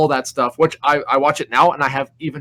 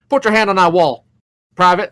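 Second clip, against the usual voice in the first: louder, higher barked order; drill sergeant command; 5 semitones higher, 7 dB louder than usual. Retold or imitated. Drill sergeant orders the private to the wall, loud. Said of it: "He's like".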